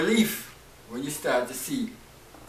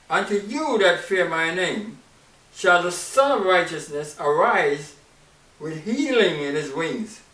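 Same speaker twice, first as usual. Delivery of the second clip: scripture reading; 3 semitones higher, 7 dB louder than usual. Acted. Preacher reading Malachi to the congregation, declaiming. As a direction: declaim the verse like a prophet.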